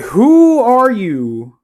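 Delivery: a high, very slow, stretched-out who are you; mock puzzled. Retelling the friend request, high and slowly drawn out in mock puzzlement. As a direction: pretend to squint at a stranger, dragging it out up high. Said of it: "I'm like".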